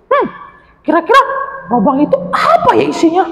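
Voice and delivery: high, wondering little voice; squeaky curious creature voice; curious little animal. Acted. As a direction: peer into the hole, curious. Anjani sniffing, wondering aloud.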